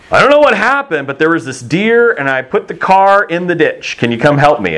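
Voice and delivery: high, sheepish son phoning dad. Retold or imitated.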